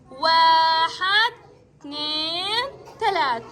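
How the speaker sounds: put-on drawn-out counting for kids to echo; slow counting drill. Shown as a demonstration. Teacher counts slowly for children to repeat after her. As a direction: stretch each number slowly so the class repeats. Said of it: "عيدوا من وراي".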